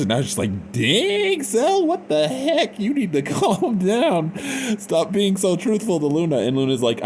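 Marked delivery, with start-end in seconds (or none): 0.7-6.4 very high, squealed mock dismay; mock shock. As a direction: exclaim high-pitched, half laughing in exaggerated disbelief. Acts out own shocked reaction.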